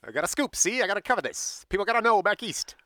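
put-on old-time reporter with Mid-Atlantic accent. Writer chasing a scoop backstage, put-on Mid-Atlantic accent. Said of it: "That is my Mid-Atlantic accent".

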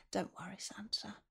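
soft reassuring whisper. Real, not acted. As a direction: hush him gently. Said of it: "Keep your voice down".

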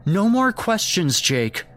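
high, sharp scolding from a mother; suddenly harsh. A narrator's reading. Mother shutting down Jake's questions, suddenly harsh. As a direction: cut the child's questions off harshly. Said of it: "suddenly harsh".

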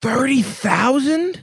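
high, drawn-out disbelief; stunned, stretching each word slowly; surprised. Real, not acted. Shocked at the trivia answer.

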